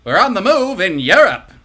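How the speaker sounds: loud, energetic fifties newsreel; newsreel announcer. Acted. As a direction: punch it like a wartime newsreel. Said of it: "energetic voice of a newsreel announcer".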